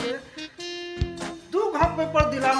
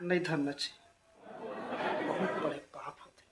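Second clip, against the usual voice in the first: put-on drunk whisper; 4 semitones lower, 19 dB quieter, slower than usual. Drunk confides moral lesson.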